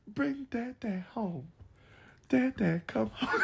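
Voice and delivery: put-on small, high, soft baby voice; pretend pleading child. Child begging for Dad to come home, pleading. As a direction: plead quietly up high like a little one.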